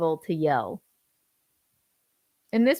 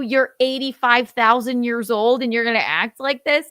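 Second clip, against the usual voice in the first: raised, loud and exasperated scolding; pissed-off go-to; 4 semitones higher, 5 dB louder than usual. Acted. Wife scolding her older husband in a fight, pissed off. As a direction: draw out an angry "grow up". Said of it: "when you're pissed off".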